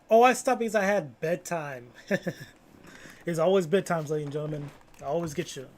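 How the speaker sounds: loud, excited chat call-out; hyped shout. Real, not acted. Streamer loudly telling chat it's always bedtime. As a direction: bellow it to the whole chat.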